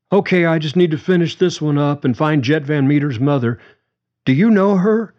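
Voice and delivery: high, rising hurried question; hurried, up in pitch; Leo's voice. Acted. Leo answering, voiced higher.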